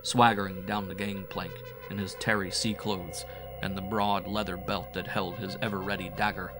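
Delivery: usual speaking voice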